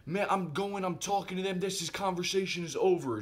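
high-pitched, fed-up imitation; imitating her snapping. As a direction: act out someone storming off upset. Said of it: "And then she snapped".

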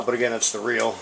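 usual speaking voice

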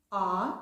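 a clear, stressed А; «А» sounded alone as a vowel; sounding out. Shown as a demonstration. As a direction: open vowel «А» on its own. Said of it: "І дивимось".

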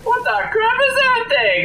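high squeal of shock; startled shriek. Real, not acted. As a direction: yelp at the sudden monster. Surprised at a monster.

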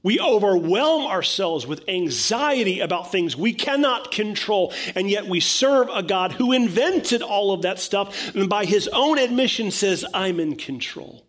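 loud, high, impassioned sermon delivery; booming, emphatic preacher; full-force preaching. Real, not acted. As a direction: drive it home to the congregation at full voice.

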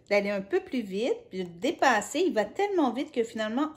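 ordinary delivery, nothing marked

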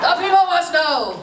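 loud, high mock proclamation; hammed-up crusading shout; rallying cry. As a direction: declare it grandly to the masses. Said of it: "twenty-nine other full-grown adults were like".

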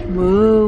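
long, loud moo; cow moo. Shown as a demonstration. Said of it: "what sound does a cow make".

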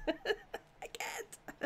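breaking up with laughter; laughing. Real, not acted. Streamer laughing at her own bad French, giggling. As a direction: laugh through the words.

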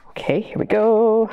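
usual speaking voice

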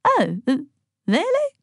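high, hesitant stammer; taken aback and unsure. A narrator's reading. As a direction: falter into a high-pitched question. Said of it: "stammered Graham".

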